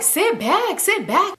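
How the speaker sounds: high-pitched mock commanding; insistent, high-voiced command; imitated bossy order. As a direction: order her back, imitating an annoyed friend. Imitates friend ordering other back.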